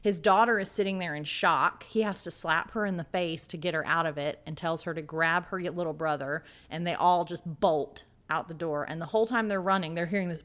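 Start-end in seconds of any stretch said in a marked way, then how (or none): none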